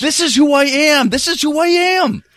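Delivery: high, defiant outburst at mother; defiant cry. Acted. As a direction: insist on who he is. Son defying his mother.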